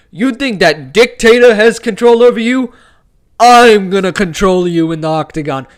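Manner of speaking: loud, high impression of a fighter's taunt; mock press-conference threat, belted into the microphone; Dricus du Plessis. Fan imagining a fighter goading his opponent about a dictator, loud cocky threat. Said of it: "I feel like Dricus is gonna".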